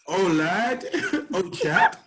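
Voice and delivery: high, hammed-up British "oh lad, oh chap"; mock British accent. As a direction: playfully put on a posh British voice while laughing. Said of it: "in the British accent".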